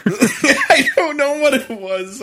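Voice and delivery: high, slowed by real laughter; laughing. Real, not acted. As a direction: say it through a laugh. Laughing at own failed accent.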